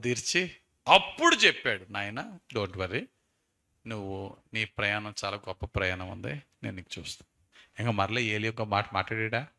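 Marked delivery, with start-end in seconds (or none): 1.9-7.3 tender comfort. Acted. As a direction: soothe a frightened son. God comforting Elijah. (భయపడిన కొడుకును ఓదార్చే తండ్రిలా మృదువుగా)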